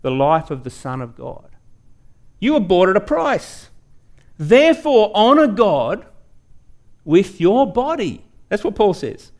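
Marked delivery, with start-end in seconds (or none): 2.4-8.2 proclaiming. Acted. Preacher proclaims quoted scripture.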